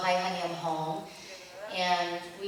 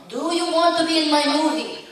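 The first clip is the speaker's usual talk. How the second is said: put-on higher, louder Italian-accented director; mock Italian accent; 8 semitones higher, 9 dB louder than usual. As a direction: imitate the Italian director insisting. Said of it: "very thick Italian accent".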